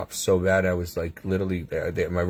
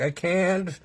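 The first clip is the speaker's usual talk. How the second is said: higher-pitched strained reply, imitated; retold helpless protest; 9 semitones higher than usual. Patient answering the team keeping him awake, retold helpless protest. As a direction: act out being unable to comply. Acting out his faint reply.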